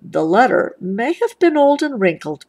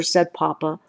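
plain reading voice

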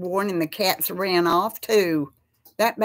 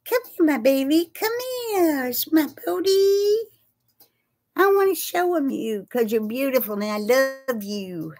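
cat voice; 6 semitones higher than usual. Real, not acted. Calling her cat over in a very high sing-song voice. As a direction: coo up high like calling a kitten. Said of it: "The cat voice".

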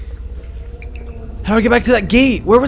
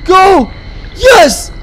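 very high, very loud frantic shouts; panicked screaming; 13 semitones higher, 8 dB louder than usual. Real, not acted. Player chased from behind, panicked screaming. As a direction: scream for your life. Monster right behind, running away.

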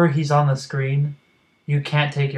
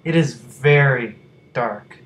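slow, weighted stress on "very dark"; emphatic stress; slower than usual. Real, not acted. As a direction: spell out "very dark" slowly, word by word. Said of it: "Let me emphasize the word dark".

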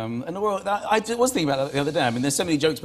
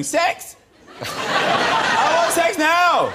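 put-on high, loud childish demand; mock tantrum shout; 7 dB louder, much slower than usual. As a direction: yell it like an overgrown toddler. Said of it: "you have to shout about something".

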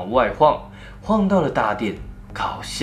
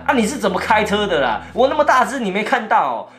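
higher, indignant yell at the driver; angry shout; 8 semitones higher than usual. Retold or imitated. Narrator yelling at the driver who hit him, indignant. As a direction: bawl him out for hitting you. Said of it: "我大声的喊".

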